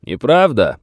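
carrying one-word shout; shouted denial. A narrator's reading. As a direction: call the denial back across the distance. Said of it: "крикнул Челси".

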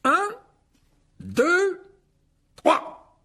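high, projected count with gaps; loud countdown. A narrator's reading. Lockhart counts off the duel, loud and spaced out. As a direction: call the count out to the hall.

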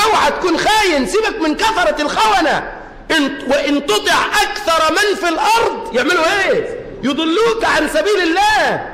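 impassioned pulpit warning; fiery preaching. Real, not acted. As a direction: warn the crowd with force. Preaching at full force.

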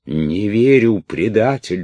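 high loud enraged yell; furious shout. A narrator's reading. Princess screams at Orlov, calling him a traitor, furious. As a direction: hurl it at the traitor. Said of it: "бешено кричала пленница".

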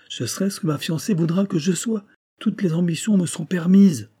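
mildly exalted suitor; ardent boasting. Acted. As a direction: declare your ambition with rising fervour. Suitor boasts of his ambitions.

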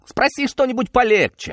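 worked-up, high-pitched comeback; exasperated exclamation. A narrator's reading. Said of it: "воскликнул он".

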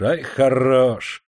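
very slow, stretched «ай, хорош»; drawn-out venomous sneer. A narrator's reading. Master mocks the worker's looks, slow sneer. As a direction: drag out «ай, хорош» to mock him. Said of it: "с ядовитой насмешкой".

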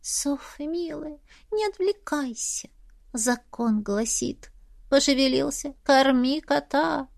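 a cat softly instructing her owner, drawn out; voiced cat. A narrator's reading. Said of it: "будто говоря".

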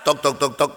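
soft knocking sound. Retold or imitated. Imitating knocking.

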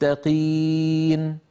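للمتقين slow, qaf said like an ordinary qaf; correct light qaf. Shown as a demonstration. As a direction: draw out للمتقين with a plain qaf. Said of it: "كما تلفظ القاف العادية".

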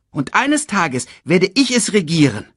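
put-on high, proud child's voice; boasting cub. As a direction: boast about your future throne. Young Simba boasts of ruling.

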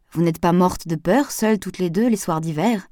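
ordinary delivery

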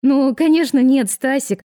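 high, loud, flustered denial; hasty babbling. A narrator's reading. Woman covers her slip to Stas, hasty babbling. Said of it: "поспешно затараторила она".

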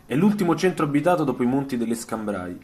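normal talking voice

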